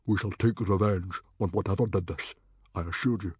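grave, measured vow; solemn. A narrator's reading. Chief vows revenge for Gobber's dragon, solemn. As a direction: promise it solemnly.

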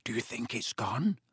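soft, breathy whisper; hushed, tentative question. A narrator's reading. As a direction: whisper it, hardly daring to hope. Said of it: "Harry whispered".

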